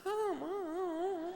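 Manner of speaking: slow, high, dainty whine mocking an effeminate man; imitating a man who talks like a woman. 여자 같은 말투의 남자를 흉내 내는 목사, 높고 느린 간드러진 목소리. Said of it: "말이 꼭 뭐 여자 같아요".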